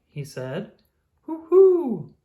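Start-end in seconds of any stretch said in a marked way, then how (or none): 1.2-2.1 high, loud hooting owl cheer. Acted. Oh the Owl thrilled by his paper heart, loud happy hoot. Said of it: "Oh the Owl cheered".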